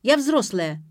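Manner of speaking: loud, high-pitched indignant protest; flaring up. A narrator's reading. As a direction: insist hotly that you deserve respect. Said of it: "взвилась Ксения".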